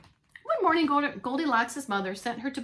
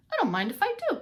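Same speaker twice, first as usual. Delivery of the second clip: cheeky Goldilocks; 7 semitones higher, faster than usual. Acted. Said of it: "said Goldilocks".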